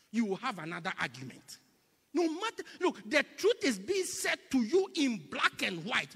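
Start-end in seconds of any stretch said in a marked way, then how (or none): 2.1-6.2 high, loud preacher's cry; preaching at full force. Acted. Preacher urging the church about the truth, high. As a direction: lift it up to the congregation.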